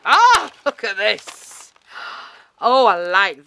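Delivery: loud, high, drawn-out "Ah"; delighted amazement. Real, not acted. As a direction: gasp out your delight, slow and high. Delighted on opening a gift.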